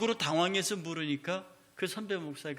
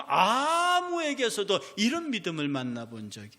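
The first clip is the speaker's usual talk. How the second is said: louder, higher earnest pleading as the centurion; preaching at full force; 4 semitones higher, 5 dB louder than usual. Acted. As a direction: preach the centurion's plea out loud and fervent. Reads Jesus' words with fervour.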